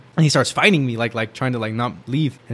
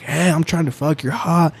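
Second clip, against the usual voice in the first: imitated drunken yelling; 5 semitones higher, slower than usual. Drunk friend grabbing a girl's hair on the train, mock yell. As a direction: call out like a pestering drunk. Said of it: "And then he's yelling".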